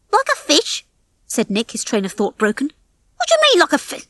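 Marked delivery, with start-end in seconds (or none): 0.1-0.8 high-pitched, incredulous echo; baffled query. A narrator's reading. Nick interrupted by Fetcher's silly remark, incredulous. Nick's train of thought broken. 3.2-4.0 very high, incredulous squawk; exasperated disbelief. A narrator's reading. Nick baffled by his partner's remark, exasperated.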